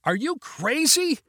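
high, stretched disbelief; alarmed outburst. A narrator's reading. Passenger objects to pilot's plan, aghast outburst. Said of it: "could not stop his reaction".

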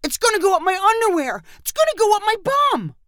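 put-on high and fast, frantic kid; panicked wail. A narrator's reading. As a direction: blurt it out in rising panic. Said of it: "Jagger cried out".

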